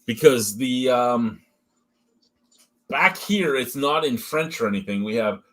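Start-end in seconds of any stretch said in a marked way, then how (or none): none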